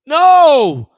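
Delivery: a loud, high, flat-out no; emphatic no. Real, not acted. Host answers whether Panthers reach Super Bowl, loud emphatic no. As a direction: shut the idea down hard.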